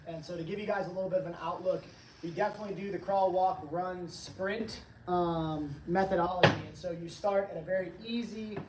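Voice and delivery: usual speaking voice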